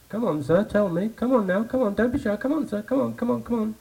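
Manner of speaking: pleading. Acted.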